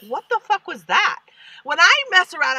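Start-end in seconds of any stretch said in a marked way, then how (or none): 0.1-1.2 a hint of a baffled listener, higher; mock baffled. Lightly voiced listener baffled by a bad note. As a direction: react like you can't believe it. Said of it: "the rest of us are like".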